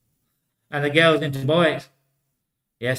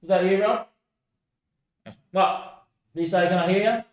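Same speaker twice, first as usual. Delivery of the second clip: a lightly voiced puzzled bloke, pitched higher; lightly voiced stranger asking if it's Rob; confused bloke; 4 semitones higher than usual. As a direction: play the bloke who can't believe he's hearing a voice, slightly higher. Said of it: "And the bloke goes".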